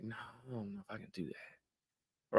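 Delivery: very hushed, slow refusal; soft refusal. Shown as a demonstration. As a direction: turn them down in a near-whisper, slowly. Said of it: "Say no real softly".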